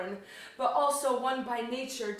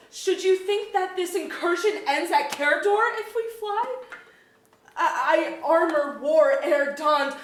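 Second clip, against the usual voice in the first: rising defiance; 5 semitones higher, 5 dB louder than usual. Acted. Queen rises to defiance.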